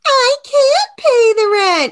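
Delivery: high, loud wailing damsel; damsel in distress. Acted. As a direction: wail it like a helpless heroine. Damsel voice in a melodrama.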